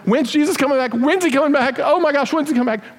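high-pitched, frantic mock panic; hammed-up freaking out. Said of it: "We shouldn't be freaking out going".

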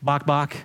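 two plainly spoken clucks; understated, deadpan chicken clucking. Acted. Chicken praising the farmer, mild clucking. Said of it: "so the chicken says".

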